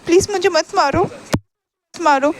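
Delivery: high tearful begging; crying plea. A narrator's reading. Shahjahan begs not to be hit, crying. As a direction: plead as if weeping, dragging the last words. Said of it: "एकदम mess में रोते हुए बताता".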